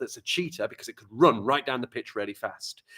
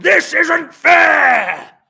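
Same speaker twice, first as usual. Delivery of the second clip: high, loud, drawn-out angry yell; furious shout; 14 dB louder, much slower than usual. Acted. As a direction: scream it like a raging gorilla. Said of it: "the gorilla shouted".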